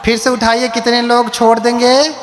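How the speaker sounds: high-pitched call to the congregation; rallying the crowd. Real, not acted. Preacher asks the congregation to raise hands again, rallying call. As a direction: call out for a response, pitched well up.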